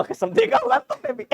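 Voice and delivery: jumps high and breaks into a laugh; cracking up. Real, not acted. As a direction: swear it and burst out laughing. Teacher cracks up at own joke. (ऊँची आवाज़ में हंस पड़ना)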